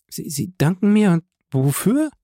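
high, anxious woman's voice; frightened bewilderment; bewildered stammer. A narrator's reading. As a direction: stumble into a high, confused question. Bewildered wife questions husband.

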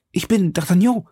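impatient rush of questions; urgent calling. A narrator's reading. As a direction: demand answers in a high, urgent voice. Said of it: "rief der junge Mann".

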